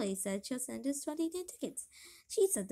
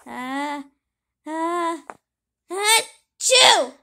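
big building sneeze; 3 semitones higher, 12 dB louder, much slower than usual. Acted. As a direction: wind up and sneeze hard. Feathers make him sneeze.

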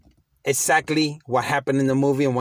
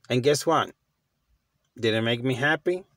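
ordinary delivery

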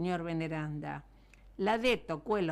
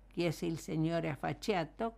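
plain reading voice

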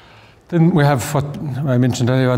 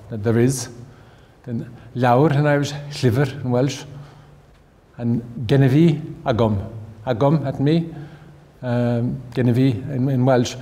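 ordinary talk

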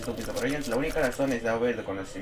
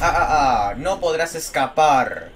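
villain taunt; 3 semitones higher, 5 dB louder, slower than usual. Acted. Taunting an enemy.

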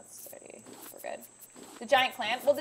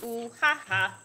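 taunting sing-song. Pretend. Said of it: "Please stop, ma'am".

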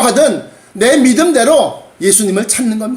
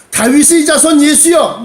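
raised, louder pleading call; desperate cry; 5 semitones higher, 4 dB louder than usual. Acted. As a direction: call out urgently to be heard. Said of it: "그 절박함을 가지고서 예수님을 부르짖는 겁니다". (절박하게 부르짖듯)

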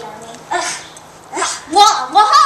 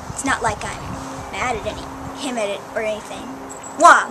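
ordinary talk

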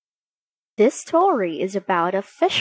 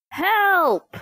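high, frightened cry; cry for help; 10 semitones higher than usual. Acted. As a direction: call out for help in alarm. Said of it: "cried the fisherman".

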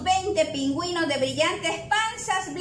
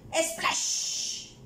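soft splash with a long hushing end; imitated splash; 5 dB quieter than usual. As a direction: mimic a plunge into water with your mouth. Narrator imitates a splash in water. (chapuzón imitado, con un largo siseo final)

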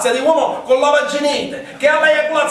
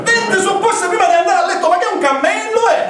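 mock outraged yell; 9 semitones higher than usual. Asked about twenty suppositories, he yells back in disbelief. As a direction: shout in fake disbelief. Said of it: "Ma perché sta gridando?".

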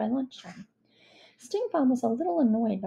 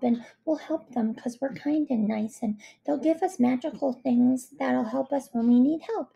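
high, cheerful, naive character voice; untroubled and eager, pitched up; Ketchup Face; 3 semitones higher than usual. Acted. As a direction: rattle on hopefully with sing-song optimism.

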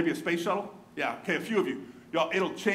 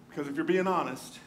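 ordinary talk

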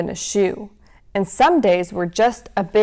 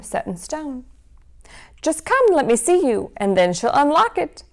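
lively storybook read-aloud; reading to children. A narrator's reading. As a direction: read it like a bedtime story for kids. Reads picture book to children.

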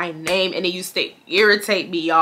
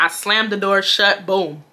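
normal talking voice

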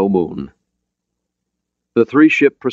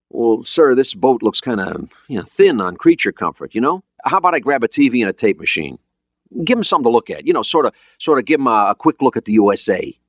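lightly voiced eager sailor, pitched higher; casual young crewman; 6 semitones higher than usual. Jones asking the skipper for a TV, casual crewman.

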